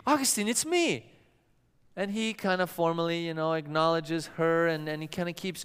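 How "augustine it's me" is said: lightly voiced woman calling his name, higher; a touch of a woman calling Augustine. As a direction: call his name eagerly, a hint of a woman's voice.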